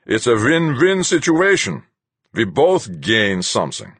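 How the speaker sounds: high, loud show of good cheer; mock geniality. A narrator's reading. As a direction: sell it with exaggerated friendliness. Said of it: "said with mock geniality".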